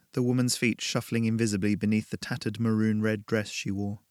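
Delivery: plain reading voice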